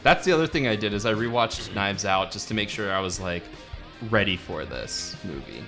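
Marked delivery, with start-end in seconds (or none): none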